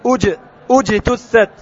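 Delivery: اجتثت begun with u, said slowly; slow correct reading. Shown as a demonstration. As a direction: model ujtuththat carefully. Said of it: "هكذا تقول".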